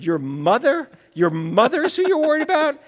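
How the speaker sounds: retold disbelief, climbing high and loud; loud, mock-astonished questioning; incredulous. Author retelling his reply to Billy, incredulous.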